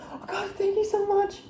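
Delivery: high-pitched gush of acted gratitude; overjoyed thanks. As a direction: squeal your thanks, play-acted. Acting out grateful, relieved mother.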